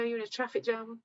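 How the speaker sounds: ordinary talk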